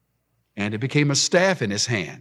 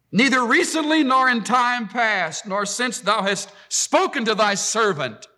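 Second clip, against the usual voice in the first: pleading Moses; 10 semitones higher than usual. Acted. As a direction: plead your lack of words to the Lord. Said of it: "Now he starts to plead".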